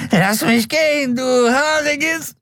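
very high, strained squeal; squealing plea. A narrator's reading. Addict held up by the neck squeals at his captor, high strained squeal. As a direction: squeal it while dangling by the neck.

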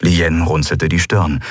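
ordinary delivery, nothing marked

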